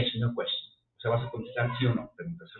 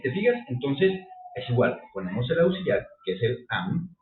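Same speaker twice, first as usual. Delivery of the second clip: ordinary delivery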